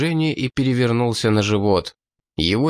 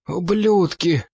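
hissed curse; 8 semitones higher, slower than usual. Acted. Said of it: "прошипел он".

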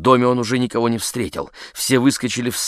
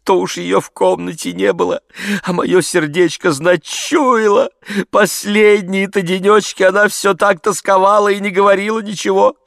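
tearful voice; 10 semitones higher, 4 dB louder than usual. A narrator's reading. Maid answers the gentleman, tearfully wailing. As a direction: wail like a distraught peasant maid. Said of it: "заговорила слезливым голосом".

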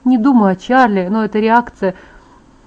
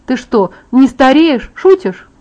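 rising, louder disbelief; incredulous; 4 semitones higher, 5 dB louder, slower than usual. A narrator's reading. Girl learns her friend does not age, incredulous. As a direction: voice climbing high in shocked questioning.

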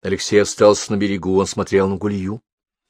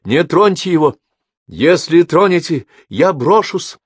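high, loud, unyielding cry; girl shouting from the cliff to protect Alexei; sharp, wound-up voice; 9 semitones higher, 5 dB louder than usual. Acted. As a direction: scream down from the cliff, refusing to give in. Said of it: "резкий, взвинченный, неуступчивый голос".

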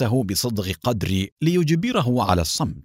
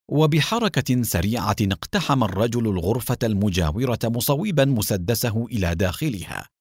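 ordinary delivery

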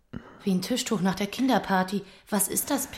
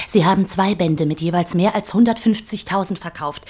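normal talking voice

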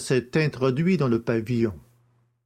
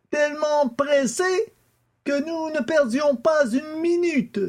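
Stangerson's voice; 13 semitones higher than usual. A narrator's reading. The father answers the judge's questions, in a much higher voice. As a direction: pitch up high as the father testifying.

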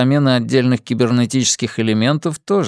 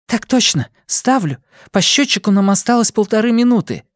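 eager crew voice; 10 semitones higher than usual. A narrator's reading. Crew member answering in a hurry.

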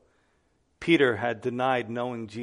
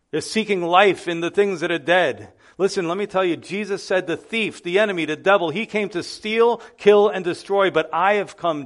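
raised, higher preaching voice pressing every need; fervent preaching; 5 semitones higher, 7 dB louder than usual. Acted. Pastor urging the church to read the Bible, fervent preaching. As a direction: preach it out to the congregation with fire.

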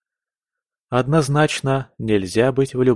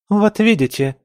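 high-pitched, aggrieved near-tears cry; tearful; 10 semitones higher, 4 dB louder than usual. A narrator's reading. Camilla appeals to her mother against her sister, tearfully. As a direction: complain with tears in the voice. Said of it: "в голосе Камиллы снова звучали слёзы".

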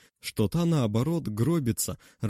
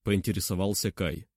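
ordinary delivery

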